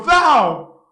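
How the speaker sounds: one loud accusing word, thrown at him; indignant cry. Acted. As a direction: fling the word at the servant in outrage. Heracles rebukes the servant.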